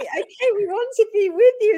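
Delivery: playful imitation of excited dogs. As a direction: blurt it out like dogs desperate to join in. Voicing excited dogs rushing over.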